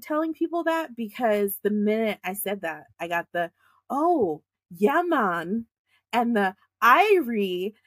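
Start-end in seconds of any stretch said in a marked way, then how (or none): 3.9-7.7 mock Jamaican accent, lightly done; bad Jamaican accent. Retelling how people mocked her family with a fake Jamaican accent. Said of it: "all the bad accents".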